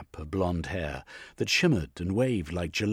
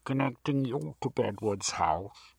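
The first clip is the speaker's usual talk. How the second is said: metallic voice on a telegraph line; thin tinny operator voice; 6 semitones higher than usual. Acted. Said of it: "he heard a tinny voice".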